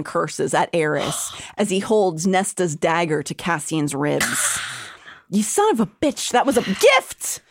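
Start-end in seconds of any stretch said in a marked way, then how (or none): 5.3-7.4 higher, indignant outburst at a traitor; Cassian cursing. Acted. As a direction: spit out the betrayal, stung. Cassian curses at Eris.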